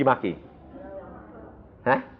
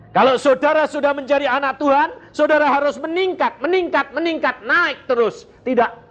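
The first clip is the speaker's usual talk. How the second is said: high-pitched, insistent pulpit exhortation; exhorting preaching; 13 semitones higher, 3 dB louder than usual. Real, not acted. Preacher urges God's children to keep rising, exhorting. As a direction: urge them on, repeating insistently.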